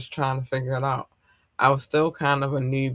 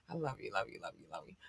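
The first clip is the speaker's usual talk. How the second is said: very soft, low murmured endearments; hushed affection; 6 semitones lower, 20 dB quieter, much faster than usual. Real, not acted. Streamer sending love to overseas listeners, hushed tender murmur. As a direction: drop to a tiny, tender undertone.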